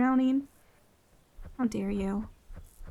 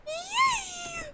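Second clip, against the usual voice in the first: squeaky cheer; 5 dB quieter than usual. Real, not acted. Thrilled on the lighthouse.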